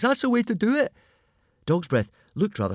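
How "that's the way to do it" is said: put-on high, loud boyish cheer; root for your friend. A narrator's reading. Fishlegs cheers Hiccup on in the fight, high loud cheer.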